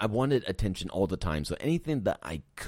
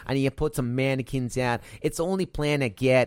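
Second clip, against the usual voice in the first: attempted New York accent; 4 semitones higher than usual. Cohost attempting New Yorker townsman scheme, New York accent. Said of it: "Did that sound New Yorkie?".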